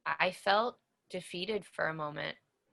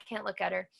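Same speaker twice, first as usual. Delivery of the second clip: normal talking voice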